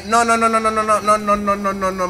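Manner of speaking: real panic as the truck slides; frantic denial at the wheel; panicked no's. Real, not acted. Crash about to happen mid-race.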